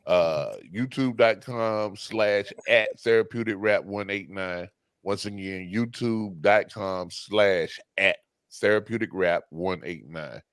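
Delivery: slow, deliberate announcer plug; promo read. Acted. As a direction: spell out the web address slowly. Reading out the channel plug.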